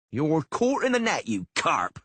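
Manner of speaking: high, loud jeering bawl; bawled taunt. A narrator's reading. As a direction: bawl a gloating taunt at the beast. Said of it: "Sheepbagger bawled".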